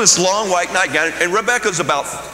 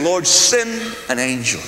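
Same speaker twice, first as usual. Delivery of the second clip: slow, earnest prayer to the Lord; slow reverent plea; hushed prayer; 2 semitones lower, slower than usual. Retold or imitated. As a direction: plead slowly for an angel. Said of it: "I'm saying".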